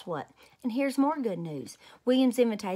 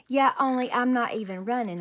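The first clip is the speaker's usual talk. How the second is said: put-on louder, sulky little-girl protest; stubborn protest; 4 semitones higher, 6 dB louder than usual. Junie B arguing with Mother about school, stubborn. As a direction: dig in and argue back.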